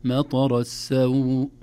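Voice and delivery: مطر السوء chanted with a shorter stretched ending; two-count madd. Shown as a demonstration. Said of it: "حركتين ما في إشكال".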